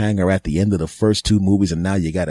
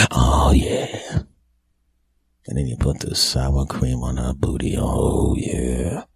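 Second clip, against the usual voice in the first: mock lustful moan; 6 semitones lower, 5 dB quieter, slower than usual. Lustful moaning over sour cream.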